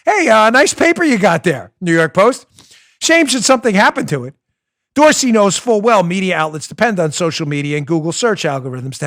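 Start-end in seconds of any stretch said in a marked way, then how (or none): 0.1-4.3 lightly voiced mob shakedown, louder; a hint of a wiseguy leaning on the paper; mob enforcer. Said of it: "sounded like every mob enforcer and shakedown artist".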